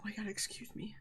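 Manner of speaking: whispering. Real, not acted. Excusing herself after a burp.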